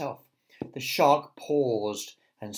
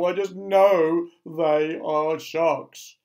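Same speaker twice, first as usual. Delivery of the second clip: loud, high, drawn-out wail; slow, doom-laden certainty; insistent earthworm; 4 semitones higher, 9 dB louder, much slower than usual. A narrator's reading. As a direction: wail each word slowly and loudly. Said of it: "said the other".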